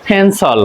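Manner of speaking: "ফেন্সল" alone with puffed p, falling tune; corrected pronunciation. Shown as a demonstration. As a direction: puff the p in "ফেন্সল", end on sol. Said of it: "কি উচ্চারণ". ("ফেন্সল" মহাপ্রাণ প, শেষে সল)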